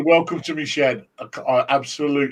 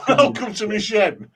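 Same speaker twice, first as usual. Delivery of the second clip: imitating Ian; 7 semitones higher, slower than usual. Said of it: "something Ian should never have said".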